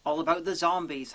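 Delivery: normal talking voice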